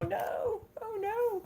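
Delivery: high squeaky mock panic; mock panicked whine. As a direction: squeak it out like a scared kid. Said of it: "I'm like".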